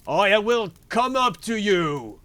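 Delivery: high, loud bellow; shouted threat. A narrator's reading. Bluebeard threatening to come up to his wife, loud high shout. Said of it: "cried Bluebeard".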